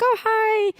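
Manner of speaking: very high sing-out greeting; high-pitched polite hello; imitated friendly woman.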